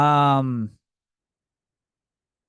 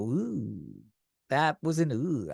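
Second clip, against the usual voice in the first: cringing ooh; 2 semitones lower, 4 dB quieter, slower than usual. Retold or imitated. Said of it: "you either laugh or you go".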